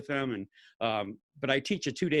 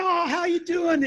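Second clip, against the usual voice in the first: much higher, slow, loud happy greeting; delighted greeting; 5 dB louder, slower than usual. Retold or imitated. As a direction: call out warmly to an old friend. Star greets old acquaintance.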